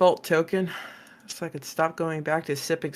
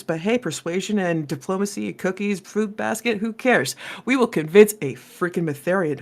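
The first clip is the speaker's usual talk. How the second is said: normal talking voice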